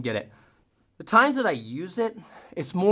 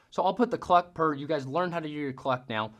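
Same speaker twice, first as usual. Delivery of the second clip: usual speaking voice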